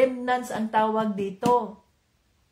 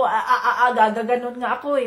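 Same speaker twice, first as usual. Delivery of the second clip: mock stutter; 5 dB louder, faster than usual. Preacher imitates Moses stammering before God, laughing. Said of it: "bulol nga ako".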